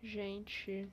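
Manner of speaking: ordinary talk, nothing marked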